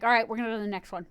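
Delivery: fast dismissive all right, next one; rushed director. Retold or imitated. Streamer mocking a rushed film director, fast and dismissive. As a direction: rattle it off to move on. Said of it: "because they were like".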